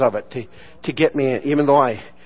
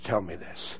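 much softer and lower, choked; to the friend on the phone, overwhelmed and near tears; tearful; 9 semitones lower, 17 dB quieter than usual. Retold or imitated. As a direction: break down as you say it. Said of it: "broke down and started crying".

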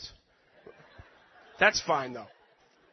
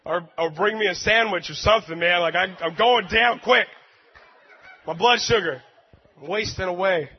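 loud comic whining for help; a hammed-up hungry worker begging for lunch; hangry plea; 7 dB louder than usual. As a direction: demand a sandwich, much louder. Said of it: "I'm like".

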